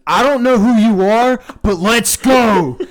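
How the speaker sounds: high, booming imitation of a fan's battle cry; hyped-up shout. Friend imitating Matthew psyching up before the urn, hyped shout. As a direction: shout it drawn out, pumping yourself up.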